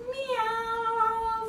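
high, long, drawn-out meow; cat meow. Pretend. Teacher arching like a cat with kids, high meow. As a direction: stretch out a singing cat's meow. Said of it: "like a cat and say meow".

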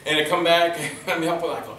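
plain reading voice

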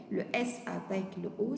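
usual speaking voice

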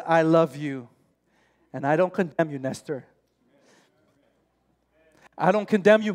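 a hint of gentle, forgiving Saviour; Jesus speaking. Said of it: "my loving Savior ... says".

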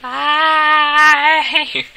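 singsong high farewell; high taunting bye. Real, not acted. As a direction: wave him off, high. Taunting the loser.

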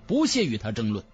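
ordinary delivery, nothing marked

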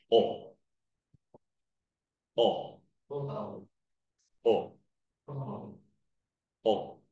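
teacher modelling the low O. Shown as a demonstration. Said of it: "你听啊".

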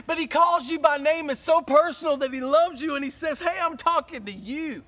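loud, high, driving preaching; preaching at full force. Acted. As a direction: hammer it home to the congregation.

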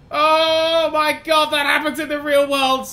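high, loud, drawn-out amazement; thrilled. Real, not acted. Player whose engine restarts, loud high thrill. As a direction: marvel loudly at the lucky save.

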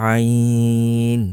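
আইন from mid-throat, vowel held very long; long-drawn আইন. Shown as a demonstration. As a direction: squeeze the start of আইন, stretch the end. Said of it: "তিন আলিফ পরিমাণ টান". (কণ্ঠনালী থেকে আইন লম্বা টেনে)

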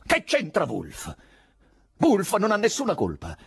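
high, heated protest; angry shout. A narrator's reading. Poet rejecting the woman's suggestion, angry. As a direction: shout it back at her, annoyed. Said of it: "gridò".

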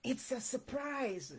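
soft, hushed secret; whispered. A narrator's reading. Owl passing the surprise on to Squirrel, softly whispered. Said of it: "whispered Owl".

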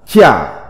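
"chả" said as Mandarin jià, falling tone; Mandarin pronunciation. Shown as a demonstration. Teacher reads out a character's Chinese reading, "chả" as Mandarin jià. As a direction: drop "chả" sharply downward, like Mandarin jià. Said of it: "Phiên âm tiếng Trung đọc là".

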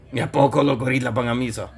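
ordinary delivery

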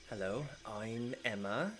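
lightly voiced noble girl, gentle and curious; Emma's voice. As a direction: speak as a poised half-elf princess. GM voices Emma.

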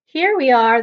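proud little announcement; pleased with herself. Acted. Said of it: "sounding pleased with herself".

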